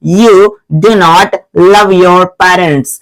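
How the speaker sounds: slow, separated English words; slow example reading. Shown as a demonstration. Teacher reading the students an example, slow and measured. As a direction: take it word by word for the class.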